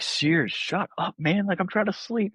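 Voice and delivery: high-pitched mock exasperation; retold frustration. Tired roommate telling a sleep-talker off, mock frustration. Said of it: "I was, like, so frustrated".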